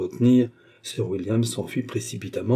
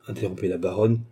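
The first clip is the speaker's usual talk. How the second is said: ordinary delivery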